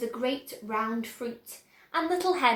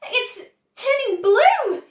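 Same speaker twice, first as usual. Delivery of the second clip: high, slow, loud panicked scream; 10 semitones higher, 9 dB louder, slower than usual. A narrator's reading. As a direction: shriek it out as a horrified mother. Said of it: "screamed Missus Beauregard".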